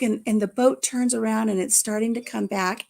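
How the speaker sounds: ordinary delivery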